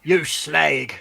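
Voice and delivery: cheeky Cockney insult; jokey Cockney jab; mock London accent. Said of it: "London accent".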